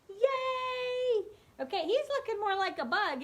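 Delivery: high loud happy cheer; excited cheer. Real, not acted. Celebrating finished legs.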